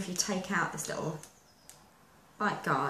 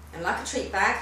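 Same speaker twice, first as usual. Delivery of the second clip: normal talking voice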